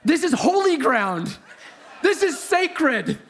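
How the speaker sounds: high, slow mock outrage; hammed-up righteous fury, pitched high; steaming indignation. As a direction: protest it as if defending sacred ground. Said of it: "steaming now".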